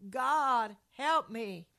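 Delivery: loud, desperate cry for help; yelling to God. Retold or imitated. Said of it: "yelled out again three more words".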